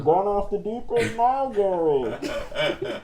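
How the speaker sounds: Bane. Acted. Parroting the co-host's worry for laughs, Bane impression. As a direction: echo it as a masked villain. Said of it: "we started doing Bane voices".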